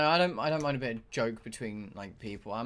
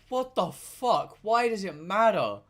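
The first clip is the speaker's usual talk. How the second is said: high, drawn-out incredulous protest; exasperated disbelief; 4 semitones higher, slower than usual. Retold or imitated. Tired worker reacting to being announced to others, exasperated disbelief. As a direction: stretch it out in exasperation. Retells his baffled reaction to coworker.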